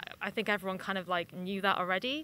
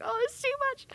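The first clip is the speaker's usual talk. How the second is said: much higher, mock overwhelmed wail; overwhelmed whine; faster than usual. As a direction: play at being swamped, pitched way up. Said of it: "and I'm just like".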